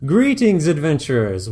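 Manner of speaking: high, loud, grandiose greeting; booming game master welcome; grand dungeon master. Acted. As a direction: open the game like a showman. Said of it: "[name] begins".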